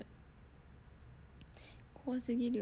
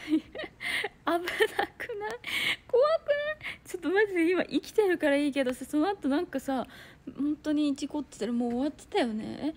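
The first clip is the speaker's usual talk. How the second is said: excited fright; 4 semitones higher, 6 dB louder than usual. Real, not acted. Telling viewers about near crash.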